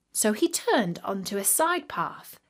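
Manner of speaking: usual speaking voice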